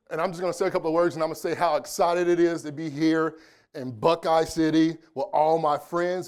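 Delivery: ordinary talk, nothing marked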